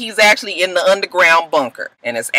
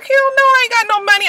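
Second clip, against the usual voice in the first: high hammed-up refusal; squeaky Melly; faster than usual. Melly refusing to chip in, high and sassy. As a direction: hell no, pitched way up. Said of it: "Melly's like".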